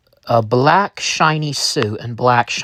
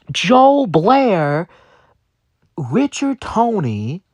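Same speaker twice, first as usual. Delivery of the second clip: much higher, incredulous inner voice; can't believe what he's hearing; incredulous outrage; 12 semitones higher than usual. Retold or imitated. Outraged inner reply to CEO.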